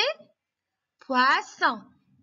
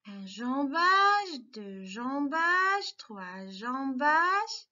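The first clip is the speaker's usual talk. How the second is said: slow counting; much slower than usual. Shown as a demonstration. Teacher writing the letter M, slowly counting strokes. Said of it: "la lettre M".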